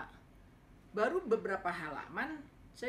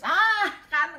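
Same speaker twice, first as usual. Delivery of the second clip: high, much louder vindicated cry; triumphant told-you-so; 9 semitones higher, 16 dB louder than usual. Retold or imitated. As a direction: crow that you were right. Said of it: "gue bilang".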